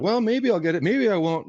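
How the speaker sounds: a hint of doubtful hoping, voiced up high; unsure listing agent. Agent mocking a doubtful pitch: high, unsure tone. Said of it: "I don't act as if, like".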